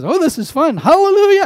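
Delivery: high, loud thrill-ride excitement; gleeful whooping. Acted. Rider on a racing donkey having fun, gleeful whooping. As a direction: build from delight to a whooping shout.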